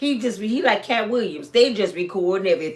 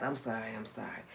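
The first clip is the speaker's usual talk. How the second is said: soft, much lower sheepish sorry; meek apology; 11 semitones lower, 16 dB quieter than usual. Retold or imitated. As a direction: mumble it, caught out. Tyler Perry apologizing meekly.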